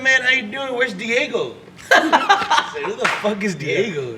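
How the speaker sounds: normal talking voice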